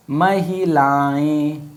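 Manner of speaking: महिलाएं stretched slowly, syllable by syllable; slow drawn-out word. Shown as a demonstration. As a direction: draw out महिलाएं slowly for the class. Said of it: "अब इसको लिखो ऐसे". (महिलाएं को धीरे-धीरे खींचकर बोलना)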